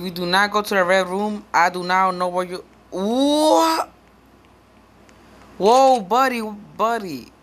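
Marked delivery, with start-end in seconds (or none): 2.9-7.3 startled. Real, not acted. Enemy suddenly appears.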